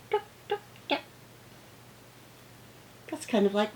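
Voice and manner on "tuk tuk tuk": scratchy toenail sound. Shown as a demonstration. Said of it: "They go tuk".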